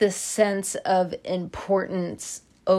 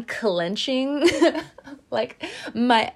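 giggling through the words; a real laugh breaking in; 6 semitones higher, slower than usual. Real, not acted. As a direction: laugh as you admit it.

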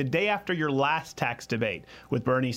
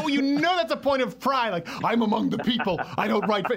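higher, put-on proud voice; mock self-righteousness; 5 semitones higher than usual. Host mocking Bernie Sanders flying economy, put-on pride. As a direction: send up a proud man of the people.

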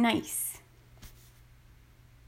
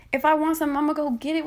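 ordinary delivery

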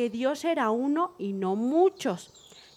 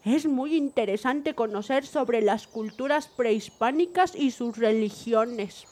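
ordinary talk, nothing marked

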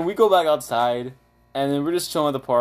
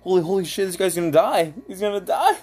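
higher-pitched exclamation of mock alarm; retold alarm; 7 semitones higher than usual. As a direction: act out a startled realization. Retelling alarm at friend's state.

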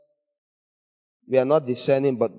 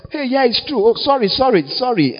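higher-pitched, flustered mock apology; hasty apology; 8 semitones higher than usual. Friend excusing themselves to go, hurried high-pitched apology. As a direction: blurt out repeated sorries to get away.

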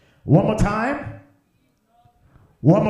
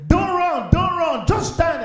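high-pitched fatherly command; raised, insistent dad voice; urgent father; 10 semitones higher, 4 dB louder, slower than usual. Acted. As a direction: call out urgently to a scared child. Dad urging frightened child to stand.

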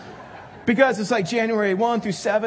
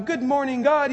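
higher, sing-song mock cheer; perky morning greeting; 8 semitones higher, 4 dB louder than usual. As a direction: parody the morning person's chirp. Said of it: "you don't wake up and go".

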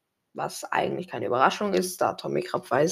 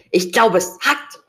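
angry; 4 dB louder than usual. A narrator's reading. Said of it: "rief Tom wütend".

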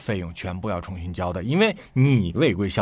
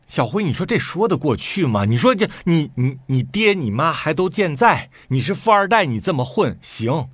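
higher and louder, building to a peak; indignant rant; 7 semitones higher, 6 dB louder than usual. Retold or imitated. Brother venting to Xiaohui about his sister, indignant rant. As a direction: vent the outrage to a friend.

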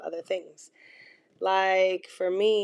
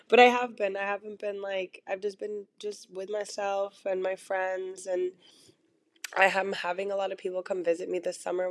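ordinary talk, nothing marked

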